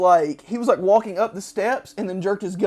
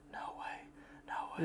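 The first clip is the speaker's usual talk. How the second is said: whispered no way; whispered refusal; 19 dB quieter, faster than usual. Retold or imitated. Last guard refusing to leave the embassy, whisper. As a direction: breathe it out, hushed and stubborn. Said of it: "He's like".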